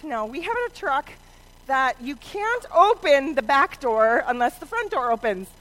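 ordinary talk

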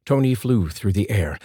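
ordinary delivery

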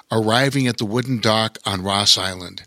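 plain reading voice

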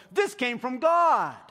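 high, loud, slow declaring; hammed-up grand announcement, stretched out; mock proclamation. Believers blessing stuff they made up, mock proclaiming. Mocking people sanctifying their own ideas.

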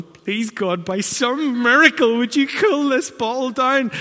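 high, loud pleading to God; desperate plea. Retold or imitated. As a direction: beg for a miracle over the noise. Desperate prayer over screaming baby.

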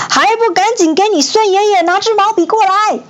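high-pitched furious command; angry roar. A narrator's reading. Enraged Sun Wukong orders a brush, roaring. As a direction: yell the order, voice pushed way up. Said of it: "怒吼着".

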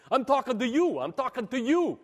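laughing New Jersey "I'm talking to you"; hammed-up New Jersey confrontation; New Jersey tough guy. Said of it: "pure New Jersey here".

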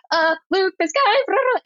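high-pitched mock panic into the walkie; panicking. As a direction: imitate a flustered plea, climbing high into blah-blahs. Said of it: "like, panicking and this guy yelling".

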